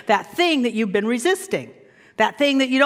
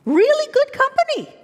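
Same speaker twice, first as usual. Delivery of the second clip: teasing; 13 semitones higher, 4 dB louder than usual. Acted. Playful emphasis, high pitch.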